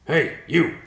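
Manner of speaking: loud, high call up to the bird. Acted. Riverpaw hailing the vulture in a tree, loud call. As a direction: shout up to the perch. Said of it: "Riverpaw called".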